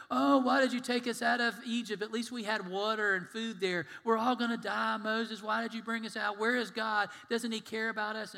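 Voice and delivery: grumbling complaint. Acted. Israelites grumble at Moses in the desert, mock complaining. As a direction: act out a string of indignant complaints. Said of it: "when they grumbled and they go".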